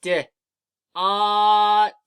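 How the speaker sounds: D then ot, each sound held long and loud; D, then ot, sounded out slowly and loudly; sounding out words. Shown as a demonstration. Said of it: "Let's say the sounds again".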